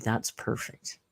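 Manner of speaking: whispered aside; dry whispered joke; sarcastic whisper. Real, not acted. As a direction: breathe it out under your breath. Sarcastic aside about karaoke.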